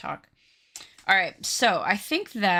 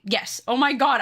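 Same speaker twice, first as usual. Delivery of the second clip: ordinary delivery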